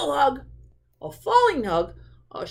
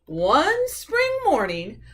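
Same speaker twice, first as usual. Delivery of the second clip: usual speaking voice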